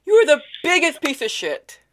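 high, loud mock rage; loud mock insult. Caller shouting insults at the scam agent Albert.

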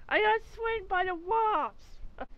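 mock pitiful drawl; drawn-out mock whine. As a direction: stretch it like a pitiful complaint.

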